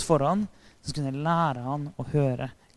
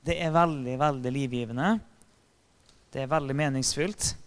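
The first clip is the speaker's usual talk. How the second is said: usual speaking voice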